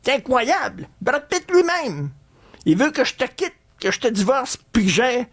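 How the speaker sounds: excited wife. Retold or imitated. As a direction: gush like a dazzled wife. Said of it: "puis ils disent".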